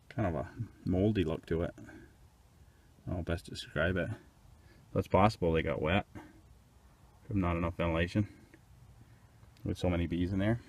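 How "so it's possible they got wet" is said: normal talking voice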